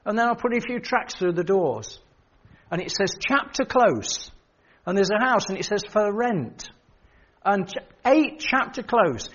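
ringing sermon voice; preacher warning the congregation; preaching. Acted. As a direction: preach it to the hall.

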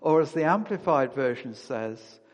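ordinary talk, nothing marked

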